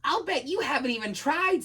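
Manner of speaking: high, huffy little dragon; indignant small-creature voice; angry. Acted. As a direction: scold crossly in a high voice. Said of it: "said the dragon angrily".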